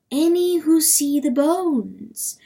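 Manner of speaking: high, slow intoning; dream voice. A narrator's reading. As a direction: intone it high, drawing the words out.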